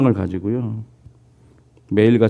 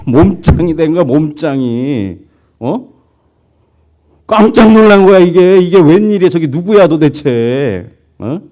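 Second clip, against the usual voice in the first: high, loud astonished outburst; amazed; 7 semitones higher, 8 dB louder than usual. Retold or imitated. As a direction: gasp it out, stunned at your own reflection. Man amazed at himself in mirror. (훨씬 높고 크게 깜짝 놀라 외침)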